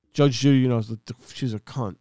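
ordinary talk

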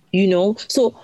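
ordinary talk, nothing marked

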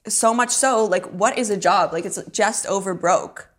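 ordinary delivery

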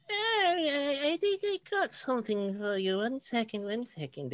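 put-on high, creaky old lady voice; very old woman. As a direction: speak like a frail old shopkeeper. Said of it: "a very, very old lady".

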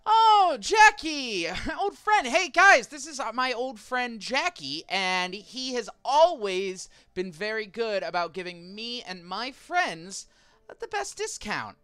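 put-on high, loud, bubbly sweet-talk to an old friend; Ophelia gushing. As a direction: gush over him like a long-lost pal. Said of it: "and she's like".

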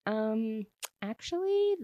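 high-pitched, drawn-out hedging; awkward hesitation. Retold or imitated. Brother calls back with awkward news, hesitant. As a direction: stall sheepishly before breaking the news.

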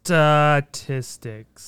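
"Statistics" drawn out slowly with a falling end; sounding out words. Shown as a demonstration. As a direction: pronounce "Statistics" very slowly, every syllable spelled out. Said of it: "Sounded it out for me in Canadian too".

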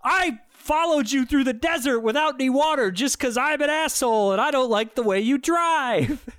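high, hammed-up villain speech; mock biker villain. Biker explaining his grudge to the teens, hammed-up villain. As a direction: declaim it as a ridiculous movie heavy. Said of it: "And then he explicitly says".

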